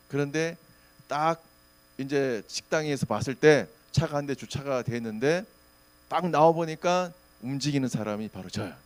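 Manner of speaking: ordinary talk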